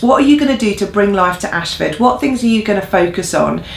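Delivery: ordinary talk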